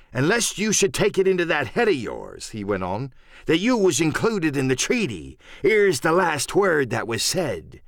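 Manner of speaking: salty, deliberate storyteller; pirate cook Silver, holding forth. Acted. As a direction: recount it like a wily pirate leader. Silver recounts the treaty talk.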